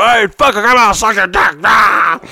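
loud, high exasperated yell; mock angry girlfriend. Pissed girlfriend giving in, mock angry yelling. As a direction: holler it in hammed-up fury. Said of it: "she would get so angry".

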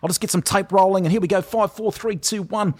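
high-pitched put-on New Zealand accent; prank-call as a chirpy Kiwi radio host; hammed-up, high Kiwi presenter voice; Kiwi radio announcer. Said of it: "this Kiwi radio announcer accent".

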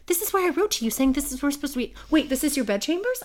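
high, rushed protest; retold flustered woman. Woman realizing it is his bedchambers, flustered. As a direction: imitate her tumbling into a startled realization.

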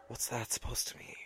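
hushed gasp of fake offence; mock offended whisper. Friend teasing her about liking gore, mock offended whisper. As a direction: whisper it, pretending to be scandalised.

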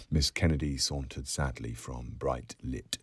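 plain reading voice

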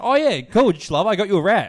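loud, upbeat dad reveal; retold enthusiasm. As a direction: imitate a dad thrilled with his gift. Dad proudly presents the gift.

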